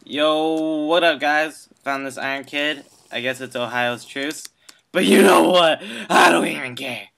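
ordinary talk, nothing marked

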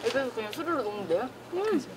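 speaking between bites; chewing while talking. Real, not acted. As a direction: mouth partly full of food. Seen 0: Bites beef at -4, chews with moving lips through labelled words.

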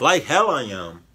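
loud, high defiant comeback; defiant retort. Pretend. Reactor answering the boy's threat, loud defiance. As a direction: fire back loudly in refusal.

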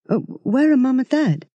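put-on uncertain, worried boy; mildly alarmed question; confused and alarmed. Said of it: "confused and alarmed all at once".

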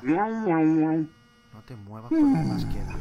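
slow loud moaning beg; drawn-out plea. Real, not acted. Player begging himself not to move, slow loud fearful plea. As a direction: stretch the plea out in fear. Pleading frozen.

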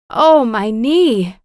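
slow, drawn-out cry of pain; hurt, near crying. A narrator's reading. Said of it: "and begins to cry".

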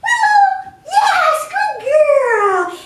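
high, loud sing-song call to the dog; bright praise, sung high and loud. Shown as a demonstration. Trainer calling the puppy by name in a sing-song. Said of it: "We want that sing-song voice".

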